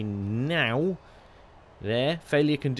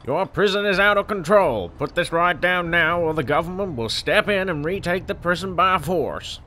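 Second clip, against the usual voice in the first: loud, higher-pitched bad American accent; terrible American accent; 4 semitones higher, 4 dB louder than usual. CEO calling about the prison riot, bad American accent. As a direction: put on an over-the-top American accent. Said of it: "That's my terrible American accent".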